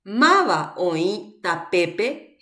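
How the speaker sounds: drawn-out teacher's reading with pauses between words; slow demonstration reading. Shown as a demonstration. Teacher reads a sentence for students to place accents, slow demonstration reading. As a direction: read MAVA OĨ TAPEPE slowly, word by word. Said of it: "Van a leer la oración".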